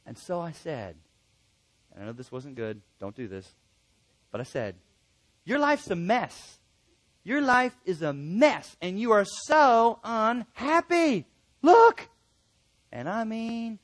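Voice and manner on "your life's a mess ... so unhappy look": retold frustration, high, slow and emphatic; frustrated outburst. Counselor confronting a crying relative, frustrated. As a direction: build to a high, loud burst on the last word. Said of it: "I was getting frustrated".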